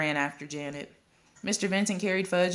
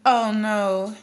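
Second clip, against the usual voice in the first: a louder, higher protest; mother calling out; 5 semitones higher, 6 dB louder, much slower than usual. A narrator's reading. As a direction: cut in loudly across the room. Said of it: "another mother called".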